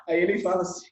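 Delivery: ordinary talk, nothing marked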